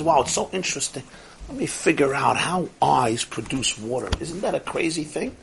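softly musing to oneself; quiet, detached curiosity; mock fascination. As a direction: play the absorbed, oblivious onlooker softly. Mock curious onlooker to crying.

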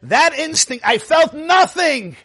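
high ringing shout of conviction; emphatic cry. A narrator's reading. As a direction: proclaim it with full force. Rebbe declares emphatically.

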